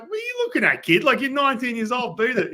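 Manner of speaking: high-pitched, incredulous telling-off; mock scolding. Pitcher shooing off a teenage admirer, mock scolding. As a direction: retell it as an exasperated brush-off.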